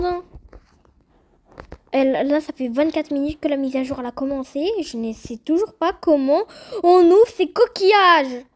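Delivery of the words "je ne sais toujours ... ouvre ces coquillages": slow, emphatic frustrated outburst; loud exasperation. Real, not acted. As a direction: drag it out, rising to an exasperated shout. Exasperated question about opening shells.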